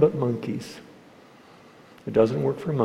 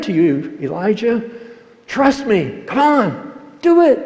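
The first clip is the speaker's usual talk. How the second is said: builds to a much higher, louder scolding rant; mock angry God; 8 semitones higher, 8 dB louder than usual. Preacher voicing what God never said to Elijah, mock angry rant. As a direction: play an exasperated God nagging Elijah, rising to a yell. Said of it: "He didn't say".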